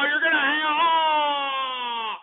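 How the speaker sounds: high, drawn-out mock wailing; mock lament. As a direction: wail in fake dismay at the caller. Mock lament at caller hanging up.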